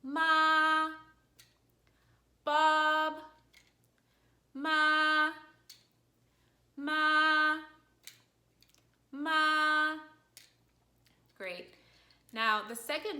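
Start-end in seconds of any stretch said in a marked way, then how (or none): 0.0-9.9 "Ma" and "Bob" held long on a high, level pitch; high monotone syllables, "ma" like "Bob"; first tone. Shown as a demonstration. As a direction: sustain each "ma" high, even and loud. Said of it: "it's gonna sound like this".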